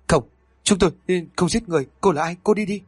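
high, shrill-edged panic, pushing the ghost away; two killers begging the ghost in terror; terrified pleading. A narrator's reading.

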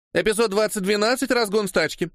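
put-on high-pitched clapperboard girl; announcing. A narrator's reading. Girl with clapperboard announcing the scene, announcing. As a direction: announce the take in a high, even voice. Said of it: "объявила девушка с хлопушкой".

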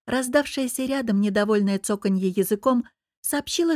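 ordinary talk, nothing marked

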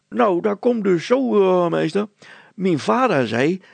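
put-on high, loud childish voice; a cheeky little girl piping up; Petronella the girl. Girl answering the mayor, high and childish. Petronella tells her story.

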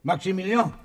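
urgent loud name-call. Acted. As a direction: shout the name to wake him. Trying to wake Robespierre.

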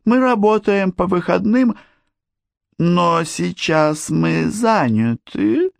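questioning doll-like voice, high; high little doll voice, unsure; breathy cutesy giggly. A narrator's reading. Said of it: "вопросительным тоном".

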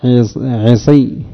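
عصي drawn out very slowly; slow demo. Shown as a demonstration. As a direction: stretch عصي for the class. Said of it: "ولك أن تقول".

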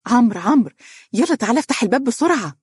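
high, quick calling out; hurried urgency. A narrator's reading. Wife rushing in to husband as the doorbell rings, urgent. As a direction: call him urgently to come at once. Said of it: "تدخل عليه مسرعة وهي تقول".

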